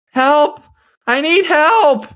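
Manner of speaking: high, drawn-out imitated distress call; mock cry for help. Stranger behind a hotel door calling again, imitated high cry for help. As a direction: wail it high, stretching the last word. The cries heard again, retold.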